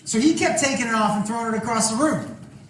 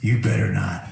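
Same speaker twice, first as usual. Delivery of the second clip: dead serious. Retold or imitated. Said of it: "And he got serious".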